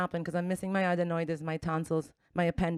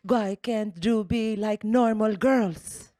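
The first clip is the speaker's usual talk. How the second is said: mock Mexican accent, slow and pleading; lightly accented Mexican mom, scolding her daughter; slower than usual. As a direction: mimic a heartbroken Mexican mom, slowed down. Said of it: "and she said".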